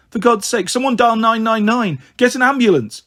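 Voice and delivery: high, alarmed call for help; urgent plea. Acted. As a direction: plead with the room to act now. Calls for emergency help.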